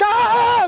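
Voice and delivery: high loud happy yell; excited scream. Retold or imitated. [name] hears [name] on the phone and yells, high and gleeful. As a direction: let out a gleeful shout. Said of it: "They screaming and laughing".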